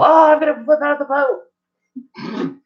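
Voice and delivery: put-on high, slow startled old-man yell; grandpa yelling. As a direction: yell out of sleep as a cranky grandpa. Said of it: "you hear him yell".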